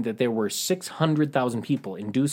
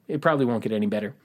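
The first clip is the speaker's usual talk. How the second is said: ordinary delivery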